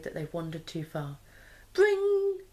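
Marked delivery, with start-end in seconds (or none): 1.7-2.4 much higher ringing bring; phone ring. A narrator's reading. Narrator imitates phone alarm.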